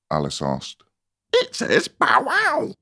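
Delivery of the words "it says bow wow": tiny squeaky flower crying out; excited high squeak; little shrill daisy. A narrator's reading. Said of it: "cried a daisy".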